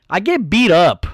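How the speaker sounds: normal talking voice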